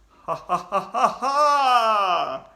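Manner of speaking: loud ghostly cackling; eerie cackle. Acted. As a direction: cackle with spooky glee. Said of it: "another eerie, cackling laugh".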